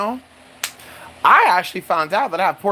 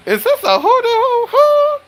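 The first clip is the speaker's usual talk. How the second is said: soaring falsetto hoot; mock astonishment; 4 dB louder than usual. Acting out a bathhouse discovery, falsetto mock astonishment. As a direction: hoot it out in a very high put-on voice.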